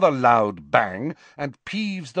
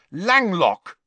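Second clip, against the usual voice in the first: firm spell cast, a bit higher; lightly voiced spell; 8 semitones higher, 4 dB louder than usual. Harry silencing Peeves with a firm spell. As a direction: snap the spell out.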